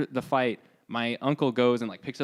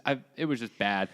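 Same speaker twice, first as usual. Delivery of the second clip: ordinary delivery, nothing marked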